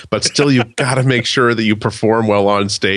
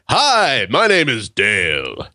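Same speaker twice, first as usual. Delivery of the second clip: smarmy date guy; much slower than usual. Retold or imitated. Mocking a slick dating guy.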